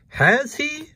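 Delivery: high squeaky-pitched questioning cat; Krazy Kat. A narrator's reading. Krazy asks the dog back, very high voice. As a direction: pipe it up high as a naive cat.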